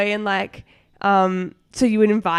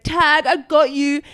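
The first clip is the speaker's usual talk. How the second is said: put-on higher, slow, sing-song kid taunt; teasing little boy; 6 semitones higher, 5 dB louder, slower than usual. Kane catching her in boys-capture-girls, slow higher taunt.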